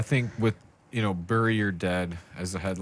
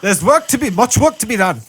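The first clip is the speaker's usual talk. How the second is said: loud, higher Cockney accent, hammed-up; Cockney peasant; 13 semitones higher, 8 dB louder than usual. Mock Cockney peasant scolding his lord, loud hammed-up Cockney. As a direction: bellow it like a Cockney serf. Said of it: "The Cockney English".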